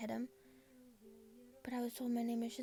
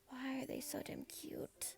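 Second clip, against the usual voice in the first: swooning whisper; 3 semitones higher, 6 dB quieter, slower than usual. Acted. Girl swooning over the boys.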